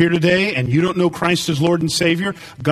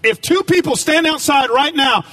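preaching; 8 semitones higher than usual. Acted. Preaching at full force.

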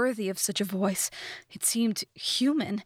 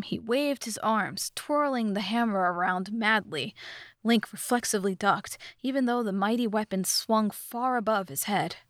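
ordinary delivery

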